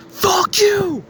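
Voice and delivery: retold furious yell, pitched very high; angry outburst. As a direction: re-enact an exploding, high-pitched curse. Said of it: "from a smile to, like, anger".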